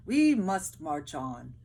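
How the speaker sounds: slow, loud, resolute command; determined soldier. Acted. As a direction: drive the tired men onward. Third soldier voiced in story.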